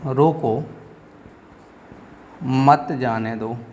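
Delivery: long pause after "रोको", then "मत जाने दो" slowly; comma pause. Shown as a demonstration. Teacher shows a comma's short stop, pausing after "रोको". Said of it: "थोड़े समय के लिए रुकना हो".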